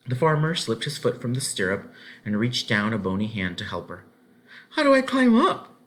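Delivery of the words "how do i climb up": put-on high, light child's voice asking; a small girl unsure how to mount; girl's voice. A narrator's reading. Ellen asking the farmer for help, high child voice. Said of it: "Ellen asked".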